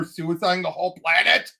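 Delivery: usual speaking voice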